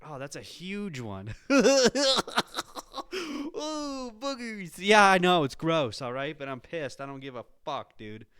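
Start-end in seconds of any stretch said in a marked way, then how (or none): 3.6-4.7 high squealing laugh, then Ooh, boogers; high grossed-out squeal; disgusted squeal. Real, not acted. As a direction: shriek in gross-out delight.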